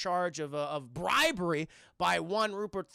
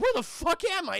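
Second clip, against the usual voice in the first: mock panicked yell; 10 semitones higher, 6 dB louder than usual. Conway wakes up panicking.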